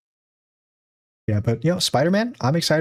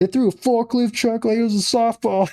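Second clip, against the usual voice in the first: movie line quote; 10 semitones higher than usual. Retold or imitated. Quoting a favorite movie line.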